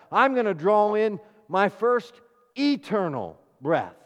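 loud, emphatic sermon delivery; forceful preaching. Real, not acted. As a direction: preach it out with punched key words.

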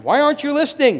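high, loud exasperated call; parent scolding. Acted. Parent calling out to children who ignore them, high and loud. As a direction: snap at the child who isn't listening.

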